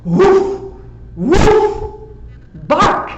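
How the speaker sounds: high, loud mock woofs; barking. Dog showing the cat its plan, loud mock barks. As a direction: bark it out like a dog.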